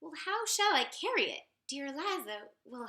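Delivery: plain reading voice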